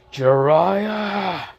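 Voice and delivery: high shouted name, full of rage; angry scream. Acted. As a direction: yell his name as you charge. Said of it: "they just hear that scream".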